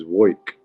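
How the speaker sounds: "woik" with an oy vowel for work; New York "woik". Retold or imitated. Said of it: "the way he says".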